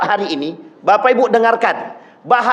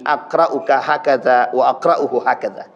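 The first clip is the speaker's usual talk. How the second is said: solemn recitation; 10 semitones lower than usual. Retold or imitated. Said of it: "Kata Nabi".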